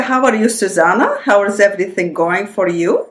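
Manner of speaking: put-on Russian accent with an Australian upward lilt. Daughter quoting parents' greeting, Russian accent with Australian lilt. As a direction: imitate Russian parents who picked up Aussie rising endings. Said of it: "they have the Russian accent, but they have the Australian lilt".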